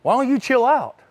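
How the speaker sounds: husband to wife over bed-making, mildly annoyed; exasperated. Retold or imitated. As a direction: toss it off, irritated. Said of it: "I was like".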